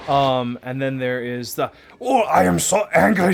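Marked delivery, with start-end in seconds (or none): 2.0-3.3 hammed-up angry German accent, higher and much louder. Shown as a demonstration. Player demoing her favourite angry German accent, hammed up. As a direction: demo an over-the-top furious German accent. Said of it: "German accent".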